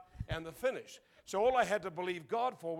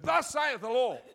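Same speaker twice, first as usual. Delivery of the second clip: loud, high, laughing finish; booming proclamation; 6 semitones higher, 8 dB louder than usual. Retold or imitated. Teen closing his first prophecy to the church, booming. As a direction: declare it with triumph. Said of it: "boom, and I gave it out".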